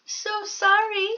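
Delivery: a tiny mouse politely angling for tea; polite little mouse. Acted. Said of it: "said the mouse".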